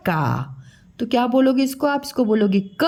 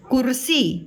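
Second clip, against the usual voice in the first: usual speaking voice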